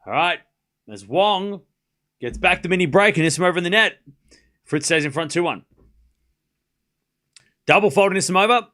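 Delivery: brisk live tennis commentary, lifting on points; play-by-play call. Acted. Streamer calling Wong against Anisimova, live play-by-play. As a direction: call each rally as it happens.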